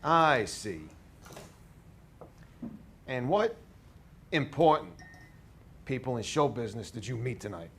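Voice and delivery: put-on New York accent. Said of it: "As thick of a New York accent as you can do".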